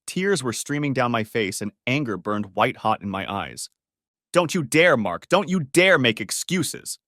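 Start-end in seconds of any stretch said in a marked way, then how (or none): 4.3-7.0 loud, slow furious hiss of warning; angry hissed warning. A narrator's reading. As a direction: spit the words at him in fury. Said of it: "I hissed".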